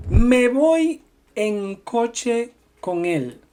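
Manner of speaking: Me voy en coche con él spoken slowly with él stressed; slow model sentence. Shown as a demonstration. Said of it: "Por ejemplo".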